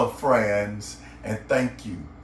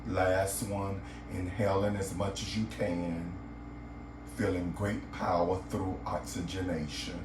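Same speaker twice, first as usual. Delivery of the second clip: guided meditation; 5 semitones lower, 8 dB quieter than usual. Acted. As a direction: guide the listener gently into relaxation, lower and softer than a greeting.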